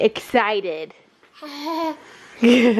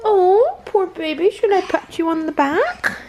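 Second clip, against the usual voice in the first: cooing; 6 semitones higher than usual. Acted. Cooing at the sad baby.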